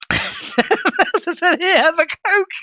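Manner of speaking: barely getting the words out through laughter; real giggling fit; laughing. Real, not acted. Laughing fit over chat message.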